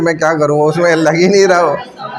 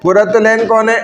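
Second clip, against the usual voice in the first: roll call; 2 semitones higher than usual. Real, not acted. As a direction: call each name clearly, sustaining the last syllable. Calls the attendance roll. (हाज़िरी लेते हुए नाम पुकारना)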